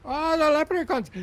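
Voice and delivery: high, loud delighted cry; excited exclamation. Acted. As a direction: burst out with glee at the thought.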